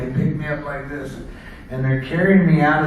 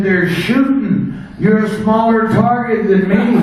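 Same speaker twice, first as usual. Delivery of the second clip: laughing excuse; 5 semitones higher, 6 dB louder than usual. Retold or imitated. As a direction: crack up while making the excuse.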